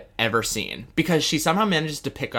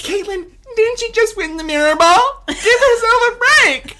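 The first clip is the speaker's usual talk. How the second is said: much higher, louder, laughing mock voice; 9 dB louder than usual. Co-host mocks her own reaction to Tayshia, high and laughing. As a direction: squeal it out in mock outrage. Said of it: "I'm like".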